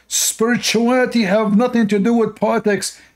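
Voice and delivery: hammed-up excited preacher; mocking excited preacher. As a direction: mimic his excited, jumping tone. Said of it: "look how he's excited and jumping".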